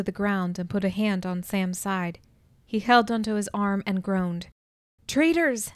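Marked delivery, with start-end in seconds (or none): 5.1-5.7 high, sharp shout of betrayal. A narrator's reading. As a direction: yell it at the traitors. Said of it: "I shouted".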